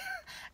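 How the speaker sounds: high breathy giggle; soft laugh. Real, not acted. Mother calling God a prick to viewers, giggling softly. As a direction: giggle at your own cheek.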